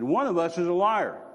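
stern, weighty divine rebuke; a displeased God rebukes him; displeased Lord. Retold or imitated. God rebukes the driver, stern and displeased. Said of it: "he said again".

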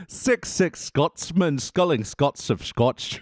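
slow, careful Scottish tongue twister; playful Scottish burr; Scottish accent. Pretend. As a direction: put on a Scottish accent, word by word. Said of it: "In Scottish".

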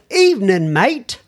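mock British greeting, mate lifting at the end; put-on British accent. Podcast host reading a UK listener's greeting, put-on British accent. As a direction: greet like a hammed-up Brit. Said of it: "the accent I'm putting on it now".

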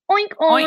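high, quick double oink; pig oink. Shown as a demonstration. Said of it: "¿Y el cerdo cómo hace?". (gruñido de cerdo agudo)

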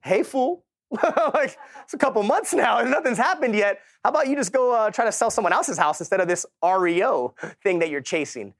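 mock his wife teasing him, half laughing. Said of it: "my wife's like".

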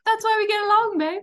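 high, bright teasing quip; playful teasing. Real, not acted. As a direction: tease her affectionately. Teasing playful retort to host.

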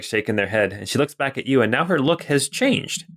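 normal talking voice

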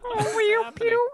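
very high swooning Piggy voice; Miss Piggy voice. Retold or imitated. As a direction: swoon over Kermit like Piggy.

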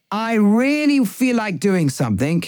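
the tempting voice inside, drawn out and pleading; craving inner voice. Retold or imitated. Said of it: "the voice inside us now speaks in the first person".

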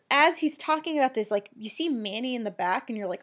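ordinary talk, nothing marked

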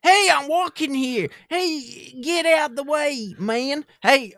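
loud, high, slow caricature New Yorker; brash, stretched-out New York impression; New York accent. Shown as a demonstration. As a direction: holler like a cartoon New York pedestrian. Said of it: "what do people from New York speak like?".

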